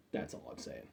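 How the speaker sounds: a whispered throwaway line; whispering the punchline of the Oscars joke; whispered aside. Real, not acted. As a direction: breathe it out as a sly whisper. Aside after joke about Oscars.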